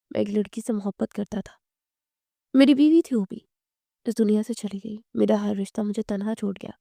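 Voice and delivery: ordinary delivery, nothing marked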